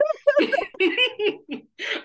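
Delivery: high laugh through tears; long tearful laugh. Real, not acted. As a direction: let the laugh run out. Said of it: "I'm not gonna cry".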